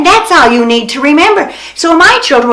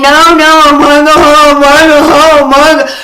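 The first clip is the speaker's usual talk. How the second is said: imitated hollering child; 2 semitones higher, 4 dB louder than usual. Mother retelling a child's tantrum in the store, mock hollering. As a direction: wail it like a kid mid-tantrum. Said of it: "it was screeching and hollering".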